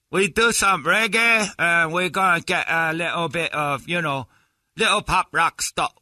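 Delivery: high, slow put-on reggae singer accent; mock Jamaican-style accent. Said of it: "how your accent's gonna".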